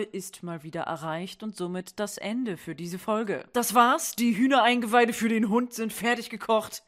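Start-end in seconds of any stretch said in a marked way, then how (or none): none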